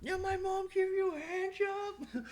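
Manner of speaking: high, slow mocking taunt; mocking kid. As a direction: sneer it like a schoolyard bully. Kids mocking the liar.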